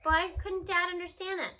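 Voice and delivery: ordinary delivery, nothing marked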